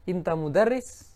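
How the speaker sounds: a question made by a rise on "mudarris"; questioning intonation. Shown as a demonstration. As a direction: ask "Inta mudarris?" by lifting the tune on "mudarris". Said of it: "menggunakan intonasi bertanya".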